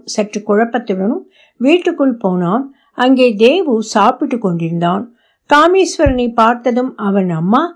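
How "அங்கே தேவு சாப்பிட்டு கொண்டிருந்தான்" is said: ordinary delivery, nothing marked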